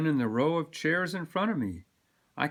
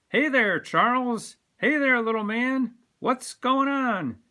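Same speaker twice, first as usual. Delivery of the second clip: higher, louder greetings across a shop; calling out; 10 semitones higher, 6 dB louder than usual. Acted. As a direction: hail them as they walk by. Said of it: "people call out as we pass".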